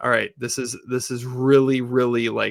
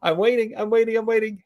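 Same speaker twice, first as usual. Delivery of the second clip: high, sing-song taunt; a light mock teasing; ball girl waiting; 10 semitones higher than usual. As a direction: tease him to come at her. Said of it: "she just stands there like".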